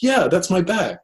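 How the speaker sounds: mock a pleased stranger claiming the bag; woman claiming the bag.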